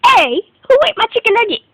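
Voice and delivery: loud angry shout; yelling across the room. Acted. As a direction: yell out who ate them. Discovering nuggets eaten, yelling.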